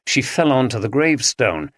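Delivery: ordinary delivery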